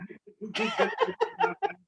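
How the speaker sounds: a big burst of rising laughter; loud laughter. Real, not acted. Guest bursting out laughing at the muted host, loud laughter. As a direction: laugh out loud, high and free.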